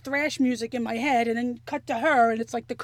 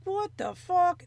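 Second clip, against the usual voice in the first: slow, higher, rising what the fuck; drawn-out what the fuck; 6 semitones higher, slower than usual. Retold or imitated. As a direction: stretch it out in rising panic. Retelling swelling symptoms, panicking.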